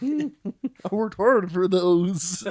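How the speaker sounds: high, slow whiny complaint; mock whiny voice. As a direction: whine like a sulking child. Mock whine about lost intelligence.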